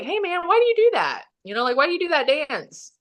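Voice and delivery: high-pitched imitation of a baffled bouncer; voiced bouncer, pitched high in disbelief; puzzled bouncer. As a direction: mimic someone asking, voice lifted way up. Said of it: "one of the bouncers was like".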